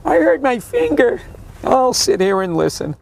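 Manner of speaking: mock an old passenger whining; whiny old person. Mocking old cab rider.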